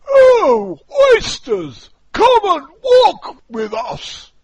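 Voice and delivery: high, loud, slow coaxing walrus. Acted. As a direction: lure the oysters in a big sweet call.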